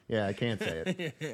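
quiet, laughing confession; breaking character giggling. Real, not acted. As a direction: giggle it out, soft and slow. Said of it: "I can't do it with a straight face".

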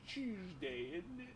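very soft, rising British catchphrase; mock British accent. Showing how they elongate their chin, mock British accent. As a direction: do a hushed British "innit" with jutted chin. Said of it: "they, like, elongate their chin".

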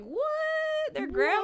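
very high, loud squeal of shock; a kid stunned by the news; hammed-up squeal of disbelief; shocked child. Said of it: "dramatic child".

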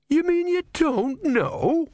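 very high, slow incredulity; appalled disbelief. A narrator's reading. Disciple appalled by his god's ignorance, high incredulity.

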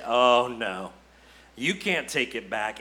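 lightly voiced inner doubter, pitched low; discouraging inner voice. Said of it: "there will be a voice in the back of your head".

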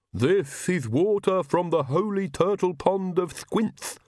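slow, high-pitched lisping servant voice; odd, lisping henchman proclaiming; Igor, the servant. A narrator's reading.

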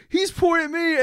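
high-pitched mock child voice; kid's high, eager exclamation; excited little kid. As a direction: claim it like a thrilled child. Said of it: "Jamie's like".

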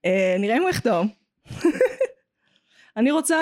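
normal talking voice

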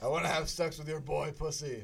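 loud put-on superhero impression; Batman. Shown as a demonstration. Podcaster teasing his friend with an impression, loud mock superhero. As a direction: deliver it loudly as a mock caped crusader. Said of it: "I've been trying the Batman one".

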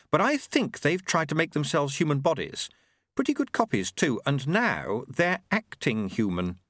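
explaining Susan. A narrator's reading. Voices Susan in dialogue.

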